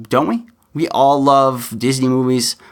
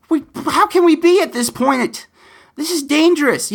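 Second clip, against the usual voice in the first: alarmed disbelief. Real, not acted. Alarmed outburst about political rhetoric.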